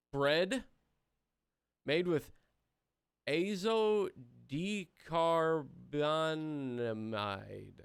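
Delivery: struggling to sound out each syllable; slow stumbling read. Real, not acted. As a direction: drag out the long chemical name very slowly. Stumbling over a chemical name.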